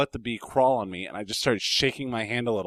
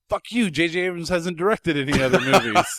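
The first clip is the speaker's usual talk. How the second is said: higher, louder indignant retort, half laughing; hammed-up indignation breaking into laughter; retold mock outrage; 7 semitones higher, 6 dB louder than usual.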